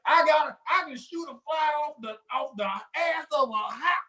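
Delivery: high, hammed-up boastful gun nut; gun bragger. Mocking gun nuts to a friend, hammed-up boasting. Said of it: "That's what they say".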